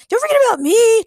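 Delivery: high, loud yelp. A narrator's reading. Chad left out by the narrator, indignant yelp. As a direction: pipe up in a high shout. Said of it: "Chad yelped".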